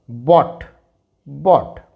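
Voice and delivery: "bought" said as "bawt", GH not sounded; "bought" modelled for students with a silent GH. Shown as a demonstration. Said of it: "When G H is silent". ("bought" में GH silent, "बॉट")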